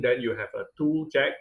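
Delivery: ordinary delivery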